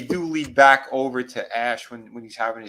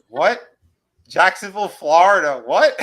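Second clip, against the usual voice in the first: loud, higher-pitched incredulous questions; mock disbelief; 5 semitones higher, 9 dB louder than usual. Recapper reacting to the odd dream destination, mock disbelief. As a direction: punch each word as if it makes no sense.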